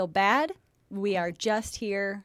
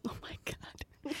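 breathy whispered aside; 14 dB quieter than usual. Real, not acted. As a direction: whisper it in disbelief. Aside reaction.

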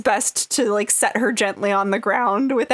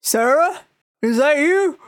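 raised, higher call of recognition; surprised stranger; 6 semitones higher, 4 dB louder than usual. Acted. Stranger in the crowd recognizing Sarah, higher surprised call. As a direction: call her name across the crowd. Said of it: "Some guy kinda looks up and he goes".

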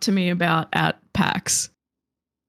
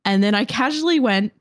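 normal talking voice

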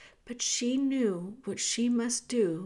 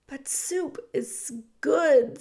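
mouse voice; 4 semitones higher, 3 dB louder, slower than usual. Acted. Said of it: "said Despereaux".

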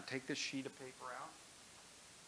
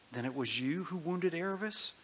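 put-on higher, surprised boy's question; boy Shasta, surprised; 6 semitones higher, 6 dB louder than usual. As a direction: realise it in surprise. Shasta asks the voice.

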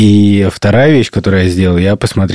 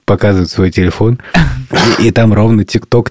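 ordinary delivery

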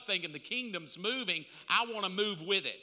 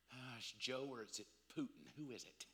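muttering to himself; 7 semitones lower, 10 dB quieter, faster than usual. Retold or imitated. Muttering suspicions at the pump.

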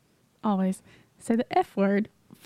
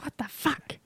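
Jersey swear impression; 3 semitones higher, 7 dB quieter, slower than usual. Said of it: "It's like".

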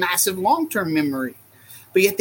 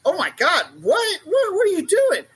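high, swooping mock astonishment; startled onlooker; 9 semitones higher than usual. Passerby startled by lizards in a stroller, mock astonished. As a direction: imitate an incredulous passerby.

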